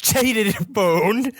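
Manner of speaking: high, slow, wailing Australian-turned-Cockney; bad Australian accent, Cockney-sounding. Ghost father discovering he was betrayed, mock-distraught Australian-Cockney accent. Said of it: "that's Cockney".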